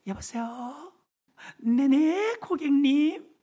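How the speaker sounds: high, sugary customer service; polite phone voice. Retold or imitated. Operator answering customers, sugary and high. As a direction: sing it like a call-centre greeting. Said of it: "전화 안내할 때 보세요".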